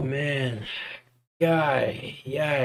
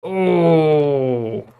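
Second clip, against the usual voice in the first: usual speaking voice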